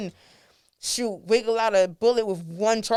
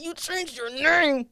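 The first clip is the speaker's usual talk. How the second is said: high, drawn-out, put-on incredulity; mock disbelief; 6 semitones higher, slower than usual. Retelling her reply to a renamed artist, mock disbelief. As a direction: stretch it out in a higher exasperated voice. Acted protest at artist's name change.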